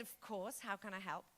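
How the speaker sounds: whispered polite reply; quiet courteous answer; soft whisper. Retold or imitated. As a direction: hush it discreetly.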